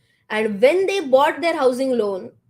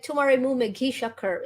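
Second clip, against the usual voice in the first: mock American accent. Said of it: "in, in their accent".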